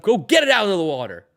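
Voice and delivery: mock tough-guy taunt. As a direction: taunt it like a cocky player. Quoting a player's taunt.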